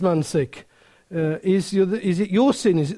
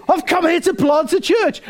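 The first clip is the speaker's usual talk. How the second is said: high, raised-voice proclamation; comic mock-heroic declaration; bold announcement; 8 semitones higher, 4 dB louder than usual. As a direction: blurt out the big mission. Acts out his bold announcement to neighbours.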